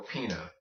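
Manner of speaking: pina as PEE-nuh; plain N in pina, no y; anglicized, no ñ. Shown as a demonstration. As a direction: pina said the American way. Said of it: "not pina".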